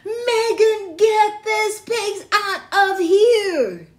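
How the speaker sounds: loud, high, slow angry shout; yell. Acted. Principal yelling at Megan to get pigs out, loud and slow. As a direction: roar the order, stretching it out. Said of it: "The principal yelled".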